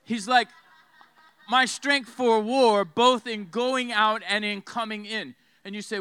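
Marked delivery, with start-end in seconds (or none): 1.5-5.4 slow, loud scripture proclamation; full-force preaching. Acted. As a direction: declare Caleb's strength, punching each phrase. Preaches Caleb's words at full force.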